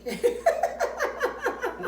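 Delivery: sudden high burst of laughter; cracking up at the joke; real laugh. Real, not acted. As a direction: let out a loud, high laugh. Friend finds the joke hilarious.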